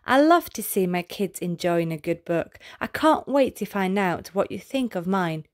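ordinary delivery, nothing marked

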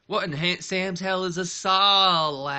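high, loud, very slow cartoon outrage; SpongeBob quote. Joking to viewers about a solid rating, cartoon voice. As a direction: ham up the cartoon bafflement. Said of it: "Little SpongeBob humor".

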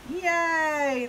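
loud, high, long hooray; whole crowd's stretched cheer. A narrator's reading. Crowd agreeing fuzz is trouble, cheering. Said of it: "the crowd cheered".

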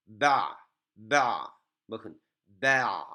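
ba, ba cut short with a catch in the throat on the ayn; stop on the ayn. Shown as a demonstration. Teacher showing students the stop on ayn, clipped and held. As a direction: close off "ba" sharply at the ayn. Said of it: "aynda durmaya çalışıyoruz".

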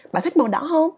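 high voice, "không" lifted at the end; rising question tune. Shown as a demonstration. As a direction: ask with "không" going up. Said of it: "ngữ điệu ở cuối câu nó đi lên". ("không" lên giọng cuối câu)

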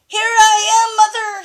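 high, loud call to the plane. Acted. Grandma reading to James, baby bird calling to the plane, high and loud. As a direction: shout up to the sky. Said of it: "he called".

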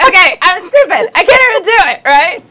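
high, loud exasperated outburst; loud self-mockery. Real, not acted. Host giving up on her accent, loud and high. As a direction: give up loudly on your own bad attempt.

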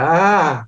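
loud, high "ah" of panic; hammed-up cry of alarm; mock panicked cry. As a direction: cry out as if caught unprepared. Acting out his panicked reaction on set.

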